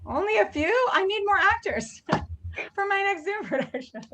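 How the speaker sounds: high, laughing plea. Real, not acted. Host teasing the cast, laughing and high. Host jokes, wants more actors.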